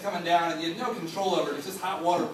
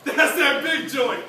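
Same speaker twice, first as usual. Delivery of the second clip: put-on cocky older kid; 6 semitones higher, 5 dB louder than usual. Naked eighth grader taunting the small boys, loud and boastful. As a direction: show off like the big man of the locker room.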